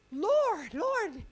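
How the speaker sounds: loud, high panicked Lord; panicked call. Retold or imitated. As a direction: act out losing focus in a panic. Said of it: "and you go".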